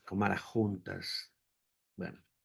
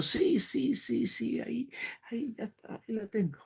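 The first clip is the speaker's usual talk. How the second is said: joyful discovery; 13 semitones higher than usual. Retold or imitated. Mother suddenly sees her daughter.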